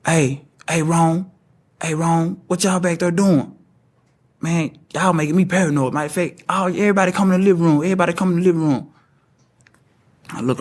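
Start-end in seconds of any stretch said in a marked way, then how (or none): 0.0-8.9 higher, fast call from the back of the house; yelling for everyone to come out, paranoid; retold scream. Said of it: "my brother screamed".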